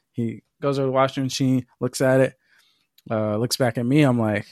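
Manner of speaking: ordinary talk, nothing marked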